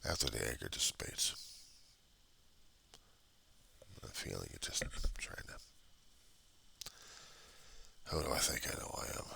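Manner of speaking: whispered, drained after the anger; barely voiced, emptied out; real exhausted whisper; hushed whisper. Real, not acted. Grief after venting anger.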